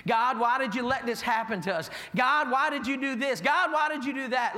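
accusing, each question harder; in his face. Retold or imitated. People confronting God, building accusing complaint. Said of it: "in his face saying".

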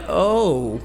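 acted disappointed "oh", drawn out; unconvincing "oh". Husband failing to admire his wife's cooking, let down. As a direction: try to sound pleased and miss. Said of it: "it comes out more like".

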